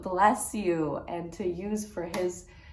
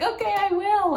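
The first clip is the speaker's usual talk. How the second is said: much higher, bright promise; eager; 12 semitones higher, 6 dB louder than usual. Retold or imitated. Vlogger promises the woman to reach out, eager and much higher. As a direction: chirp it keenly.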